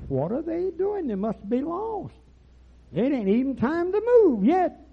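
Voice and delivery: very high, drawn-out wondering; mock puzzlement. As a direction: exclaim it slowly, way up high, in acted bewilderment. Acted puzzled question about flying geese.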